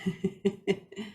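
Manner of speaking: soft little laugh; light chuckle. Real, not acted. Host amused by her be, be, be wordplay, soft chuckle. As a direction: chuckle softly to yourself.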